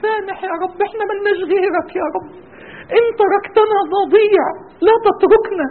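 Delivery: rising, impassioned supplication, voice breaking into tears; fervent prayer. Real, not acted. Preacher leading the congregation in tearful prayer. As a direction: pour out a tearful plea to God.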